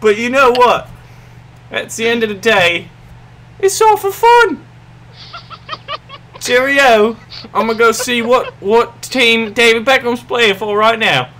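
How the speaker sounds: high, hammy British sign-off; terrible British accent. Acted. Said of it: "sorry for my terrible accent".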